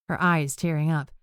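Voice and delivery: usual speaking voice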